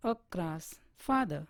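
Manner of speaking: usual speaking voice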